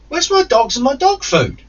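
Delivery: very high, loud indignant question; outraged high demand. Retold or imitated. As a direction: push the voice right up in disbelief. Retold demand for missing dogs.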